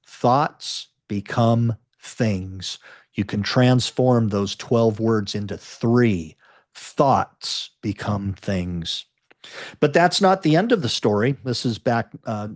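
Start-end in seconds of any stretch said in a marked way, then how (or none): 0.1-2.8 slow emphasis. Real, not acted. Reader stresses a key three-word idea. 6.7-9.1 very slow, low and deliberate; low, drawn-out key phrase; slow, weighty emphasis. Real, not acted. Three-word summary repeated for weight.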